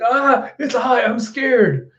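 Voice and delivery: high, drawn-out whine of fear; acted-out scared squeal; mock terrified cry. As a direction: wail like a frightened child character. Momo terrified in midair.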